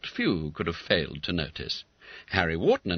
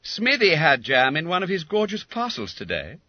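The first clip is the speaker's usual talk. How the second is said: schoolboy remark; 10 semitones higher, 4 dB louder than usual. A narrator's reading. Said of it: "remarked Bob Cherry".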